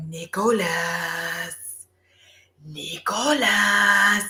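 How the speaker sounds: slow eerie drawn-out call; sorceress calling. Retold or imitated. Sorceress in the book calling Nicholas, slow and eerie. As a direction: lure him by name like an evil sorceress.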